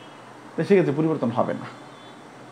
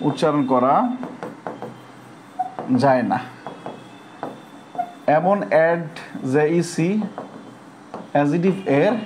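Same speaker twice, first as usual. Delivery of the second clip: "উচ্চারণ করা" then "যায় না", with long pauses between; spaced-out dictation pace; slow dictation; 3 semitones lower, 3 dB quieter than usual. Shown as a demonstration. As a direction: dictate it slowly so students can write it down. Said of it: "লিখবেন".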